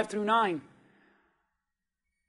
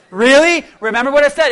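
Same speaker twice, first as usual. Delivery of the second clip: usual speaking voice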